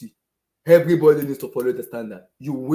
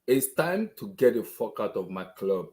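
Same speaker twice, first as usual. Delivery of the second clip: ordinary delivery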